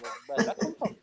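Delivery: laughing disbelief. Retold or imitated. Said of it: "He's like".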